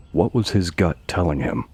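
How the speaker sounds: ordinary delivery, nothing marked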